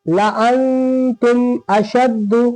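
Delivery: "लाअंतुम अशद्दु" very slowly, holding the nasal n; recitation model. Shown as a demonstration. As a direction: draw out "लाअंतुम अशद्दु" syllable by syllable. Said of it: "फिर क्या है?".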